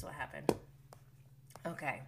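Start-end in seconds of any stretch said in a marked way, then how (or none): none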